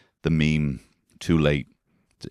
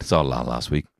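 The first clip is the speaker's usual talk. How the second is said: lower, cutting reply; dagger twist; 4 semitones lower than usual. Retold or imitated. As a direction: twist the knife. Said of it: "twist the dagger".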